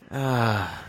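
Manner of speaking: usual speaking voice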